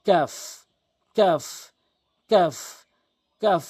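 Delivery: "কাফ" with k just ahead of the tongue root; kaf, further forward. Shown as a demonstration. Teacher drills kaf against qaf, "কাফ" with forward k. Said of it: "জিহ্বার গোড়া হতে একটু আগে বাড়াইয়া".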